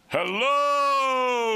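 loud high shout from above; booming hello to the old lady; calling out. Retold or imitated. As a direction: yell down at her from the scaffolding. Construction worker calling down.

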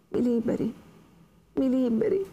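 high, rising plea; pleading. Acted. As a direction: beg to be set free, voice climbing. Lucia begs the Innominato. (supplica acuta)

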